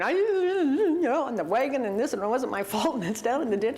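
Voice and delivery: hammed-up blubbering excuse to dad; mock blubbering. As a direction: wail the first word, then babble through pretend sobs. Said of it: "I'm just crying and blubbering".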